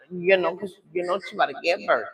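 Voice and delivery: normal talking voice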